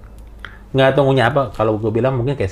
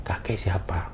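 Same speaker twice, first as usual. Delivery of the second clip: hushed, softer whisper; 5 semitones lower, 9 dB quieter, slower than usual. Retold or imitated. Frightened young man asking the old stranger, whispered. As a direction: ask it under your breath, awed.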